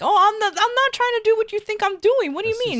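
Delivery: imitating the girlfriend's high protest; mocking girlfriend voice. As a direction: parody her squeaky denial. Girlfriend's denial voiced in story.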